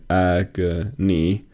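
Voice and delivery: "Agony" slowly split as A-go-ny, weight on first and last syllables; syllable stress demo. Shown as a demonstration. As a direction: sound out "Agony" slowly, middle syllable unemphasized. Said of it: "the E sound receives the emphasis".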